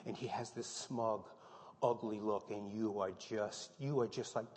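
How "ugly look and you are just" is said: ordinary talk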